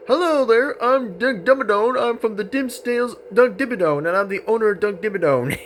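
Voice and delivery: high cowboy character voice; big cartoon cowboy introducing himself; Doug Dimmadome. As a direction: put on a high-pitched cowboy twang. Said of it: "Because it sounds like this".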